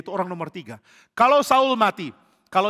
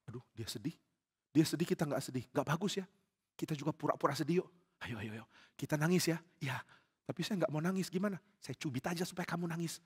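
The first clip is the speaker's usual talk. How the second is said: very soft, secretive back-and-forth; conspiratorial hush; 3 semitones lower, 13 dB quieter than usual. Acted. Followers plotting to fake tears before David, hushed and secretive. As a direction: plot under your breath.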